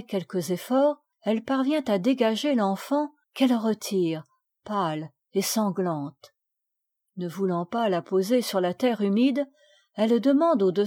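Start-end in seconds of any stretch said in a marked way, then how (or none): none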